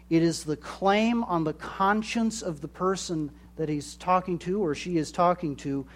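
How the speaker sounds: ordinary talk, nothing marked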